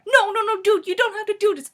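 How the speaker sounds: high, loud, urgent protest; acted-out panic; alarmed pleading. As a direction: stop a friend in a hurry. Begging friend to stop eating.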